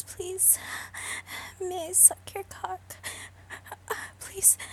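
normal talking voice

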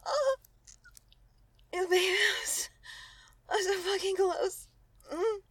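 loud high moaning cry. Acted. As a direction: cry it out near climax. Nearing climax.

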